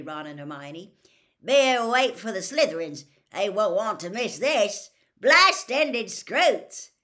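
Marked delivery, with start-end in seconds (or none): 1.4-6.9 Hagrid excited. A narrator's reading. Hagrid announces his new creatures to the class, loud and proud. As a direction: bellow it like a proud showman.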